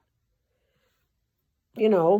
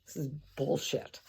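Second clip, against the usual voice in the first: muttered aside; 4 semitones lower, 12 dB quieter than usual. Real, not acted.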